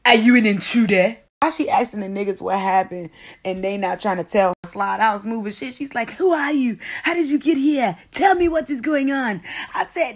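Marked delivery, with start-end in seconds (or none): 0.1-1.2 put-on loud African-accented challenge; African lady. African lady questioning a stranger, loud African accent. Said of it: "The African lady". 6.1-9.4 put-on high-pitched, demanding African accent; African lady. African lady demanding to know what happened, African accent. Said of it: "The African lady comes out of nowhere yelling".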